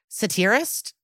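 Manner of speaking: "Satirist" as suh-TEER-ist, questioning rise; unsure mispronunciation. Real, not acted. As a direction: try "Satirist" with a stressed "teer", asking. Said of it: "How do you say that word?".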